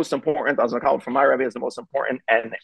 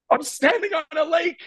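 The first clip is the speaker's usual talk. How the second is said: put-on high, wide-eyed kid voice, much higher; amazed little boy. Son on the frozen pond tells his dad, high amazed child voice. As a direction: squeal it like a thrilled child on ice.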